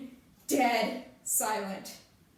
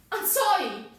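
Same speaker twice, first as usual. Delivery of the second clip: raised, high apology falling away; rattled outburst; 7 semitones higher, 6 dB louder than usual. Acted. Sonny answers a yelling interrogator, rattled raised voice. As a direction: blurt it loudly under pressure. Suspect reacts to being yelled at.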